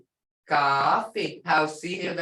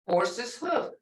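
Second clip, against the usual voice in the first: ordinary talk, nothing marked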